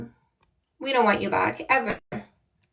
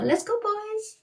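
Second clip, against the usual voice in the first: hyped call; 10 semitones higher, 3 dB quieter than usual. Real, not acted.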